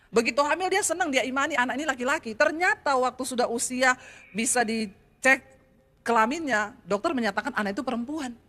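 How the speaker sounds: ordinary talk, nothing marked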